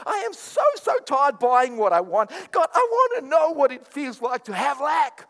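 mock desperate prayer. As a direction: beg God for poverty in an overblown wail. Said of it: "None of us pray like this".

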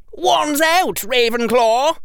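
loud, high-pitched rallying shout; high boyish war cry. A narrator's reading. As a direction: yell the house to arms. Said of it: "shouted Michael Corner".

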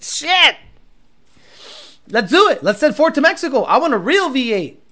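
high, eager mock enthusiasm; excited joking. Real, not acted. Joking about sending Ford to Mexico, high mock eagerness.

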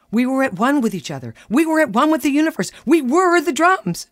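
mock her blissful drum trance; soaring high ecstatic build; rising rapture. Husband echoes her rapture.

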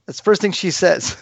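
high laugh through the words; real amused laugh at his mom's line. Real, not acted. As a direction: laugh in disbelief.

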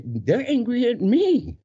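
high, stretched-out astonishment; mock realisation. Dancer realising the crowd is against him, high slow shock. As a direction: drag it out as it dawns on you.

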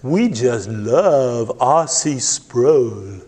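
put-on slow drawn-out Southern matriarch accent; slow Southern lady drawl; thick Southern accent. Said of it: "in her thick Southern accent".

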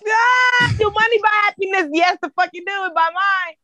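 emphatic shout. Real, not acted. Answering whether money buys happiness, emphatic high shout. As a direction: holler the answer with total conviction.

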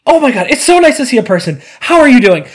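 high, fast, gushing; eager chatty mom. Retold or imitated. Lonely mom greeting a stranger, fast and gushing. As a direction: pour it out, thrilled to meet anyone. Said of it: "She goes".

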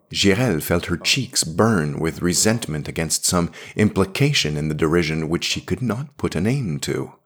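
plain reading voice

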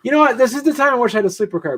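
loud, high, rushed mock outburst; exasperated. Passenger retelling reaction to a muttering seatmate, mock exasperation. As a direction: blurt it out in comic exasperation.

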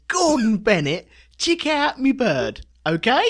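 high, loud, slow Cockney geezer; Cockney Uncle Fred. Retold or imitated. Voicing Uncle Fred's slang in loud high Cockney. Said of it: "Uncle Fred says".

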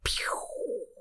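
whispered laser pew; soft blaster noise; pew sound effect. Pretend. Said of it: "I'm adding my own sound effects".

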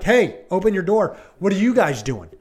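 loud mock order through a door; mock pushy cop. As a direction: play a bossy cop demanding entry.

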